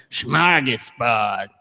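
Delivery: slow, drawn-out funny accent with dropped Rs; stretched-out comic accent, R's softened away. Shown as a demonstration. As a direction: say SMORGASBORD in a silly non-rhotic accent. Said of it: "in, like, a funny accent".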